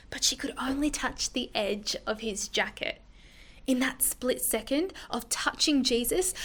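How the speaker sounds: whisper. A narrator's reading. Hushed moment of touching Jesus.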